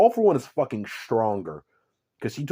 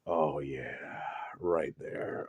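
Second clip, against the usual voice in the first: hammed-up low moan; 8 semitones lower, 10 dB quieter, much slower than usual. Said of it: "And Deku's like".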